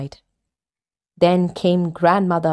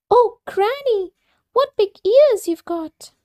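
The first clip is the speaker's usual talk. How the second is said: put-on much higher, wondering girlish voice; wide-eyed little girl; little girl amazed.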